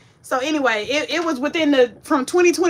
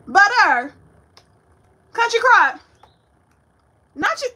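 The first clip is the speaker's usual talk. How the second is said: surprised dismay; 8 semitones higher, 6 dB louder than usual. Real, not acted. Finding butter missing, dismayed.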